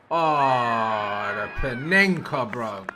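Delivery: stunned delight at the Panenka replay; excited. Real, not acted. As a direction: marvel at the cheeky finish. Reacting to a cheeky penalty replay.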